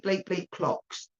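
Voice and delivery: ordinary talk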